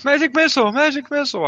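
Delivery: high-pitched mock spell call; larper casting a spell. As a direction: cry out spells like an excited larper, hammed up.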